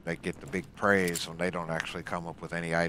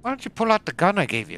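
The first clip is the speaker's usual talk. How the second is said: calling out; 13 dB louder than usual. Real, not acted. Calling out to Vanessa.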